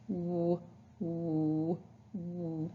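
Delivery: quiet rounded w; soft W sound. Shown as a demonstration. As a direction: round the lips, w. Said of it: "putting your lips together".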